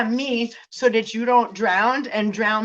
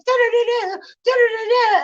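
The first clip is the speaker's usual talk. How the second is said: high, loud nonsense babble; mock babbling; 13 semitones higher, 5 dB louder than usual. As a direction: babble like he isn't listening. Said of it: "and he's like".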